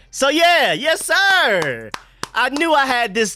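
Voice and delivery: high, loud, drawn-out whoop; jubilant, high-pitched shout; triumphant holler. Real, not acted. Celebrating having the roster ready.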